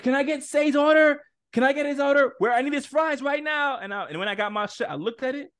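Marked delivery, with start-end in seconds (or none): 0.0-3.8 high, raised voice calling to the kitchen; urgent order call. Retold or imitated. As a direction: imitate someone demanding an order right away. Acted urgent order call at counter.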